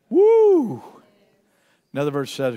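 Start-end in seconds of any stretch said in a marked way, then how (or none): 0.1-0.8 high, loud, drawn-out woo; exultant whoop. Real, not acted. Preacher thrilled by a loved verse, whooping. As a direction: whoop with delight, leaping up in pitch. Delighted by verse just read.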